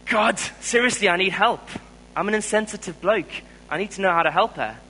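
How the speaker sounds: high, loud, pleading prayer; a man pleading with God, high and loud; urgent plea to God. Retold or imitated. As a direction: beg God for help, overwhelmed.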